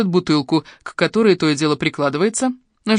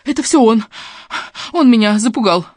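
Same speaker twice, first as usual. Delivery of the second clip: raised, loud, anxious protest; high, flustered self-defence; agitated gabbling; 5 semitones higher, 4 dB louder than usual. A narrator's reading. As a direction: blame him in a panic. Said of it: "вдруг зачастила Жанна".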